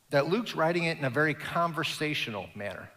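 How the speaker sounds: normal talking voice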